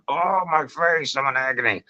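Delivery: mock moan of pain; pained wail. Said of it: "you're suddenly gonna be going".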